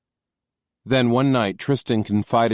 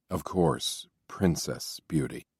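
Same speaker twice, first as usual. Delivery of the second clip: softer, reverent murmur of Princess Beauty; 3 semitones lower, 7 dB quieter, slower than usual. A narrator's reading. Laurent answering the captain, soft and reverent. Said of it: "softly, reverently".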